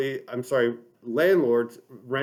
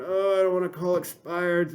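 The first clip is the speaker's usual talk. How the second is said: groaning agent; 5 semitones higher than usual. Retold or imitated. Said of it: "people groan".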